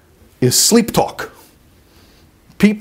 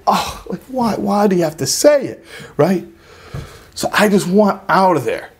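ordinary talk

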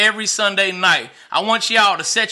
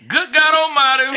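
amused exclaim; 6 semitones higher, slower than usual. Real, not acted. Cheering on the wife's point.

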